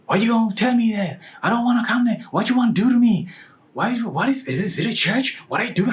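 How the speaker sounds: high, loud mock indignation; offended. Offended woman answering a church invitation, high indignant protest. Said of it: "She just get offended".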